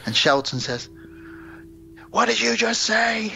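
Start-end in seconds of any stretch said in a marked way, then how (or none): 2.1-3.3 high-pitched, affronted challenge; mock outrage. Shelton challenging the cadet who swore at him, high mock outrage. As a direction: act out a bully demanding a repeat, pitched right up. Bully challenging a muttered insult.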